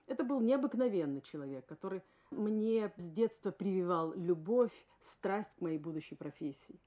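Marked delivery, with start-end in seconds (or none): none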